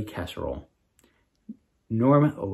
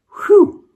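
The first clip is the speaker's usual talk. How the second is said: relieved whew; 11 dB louder than usual. A narrator's reading. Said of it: "said Wisp".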